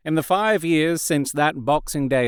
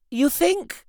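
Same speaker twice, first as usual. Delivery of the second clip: a sharply higher, startled question; astonished. A narrator's reading. Ursula to Anne, astonished and much higher. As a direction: caught off guard. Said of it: "astonishment clear on her face".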